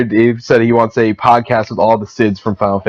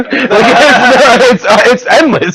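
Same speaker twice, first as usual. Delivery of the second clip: higher, talking through laughter; cracking up mid-sentence; giggling about the endless list; laughing; 10 semitones higher, 3 dB louder, much slower than usual. Real, not acted.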